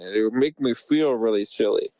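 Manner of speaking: ordinary talk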